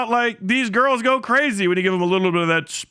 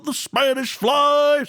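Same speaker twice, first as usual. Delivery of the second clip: mock shout; 7 semitones higher, much slower than usual. Streamer mimicking the old Cosby record, mock shouting. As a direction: belt it like a comic punchline.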